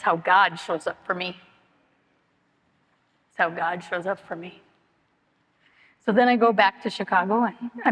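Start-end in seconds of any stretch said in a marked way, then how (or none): 3.3-4.6 repeated quietly, sinking into it; soft echo. Real, not acted. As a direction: let the line fall away softly, as a quiet afterthought. Soft echo of the line before.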